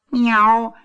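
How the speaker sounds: long, high mew; content meow. A narrator's reading. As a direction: answer with a cat's meow. Kitten answers farmer's wife.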